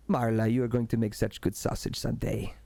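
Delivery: farmer fondly talking to his pig. Acted. Farmer Emil talks to his pig.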